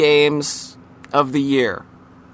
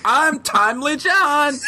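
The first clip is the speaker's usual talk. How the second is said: catchphrase shout; 10 semitones higher, slower than usual. Pretend. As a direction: burst out yelling the catchphrase. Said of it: "That's even his catchphrase".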